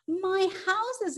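high, anguished storybook cry; exclaiming. A narrator's reading. Woodpecker seeing his wrecked houses, high exclamation. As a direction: cry out in hammed-up dismay. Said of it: "exclaimed Woodpecker".

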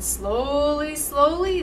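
"slowly, slowly" stretched high and loud; storyteller lingering on "slowly"; drawn-out slowness. A narrator's reading. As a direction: drag out each "slowly" to match the crawl. Narrating a very slow journey.

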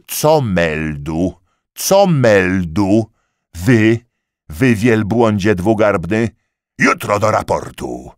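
loud, slow mocking yell; mock his stammer, then roar; furious mockery. A narrator's reading. Lieutenant mocking the corporal's report, yelling loud.

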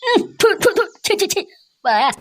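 high-pitched spluttering revulsion; spitting in disgust. Acted. Friend spitting out cheap liquor, disgusted. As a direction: spit it out, repelled by the taste. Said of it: "আর এখন ছি ছি করছে".

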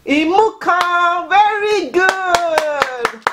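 high, drawn-out singsong cheer; delighted praise. Real, not acted. Teacher praising a child's right answer, delighted singsong. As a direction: stretch it out high with real delight.

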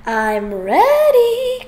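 high, loud and drawn out; a long, loud high "I'm ready"; crying out. Acted. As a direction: stretch it out proudly. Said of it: "cried Froggy".

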